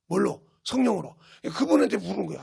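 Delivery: ordinary talk, nothing marked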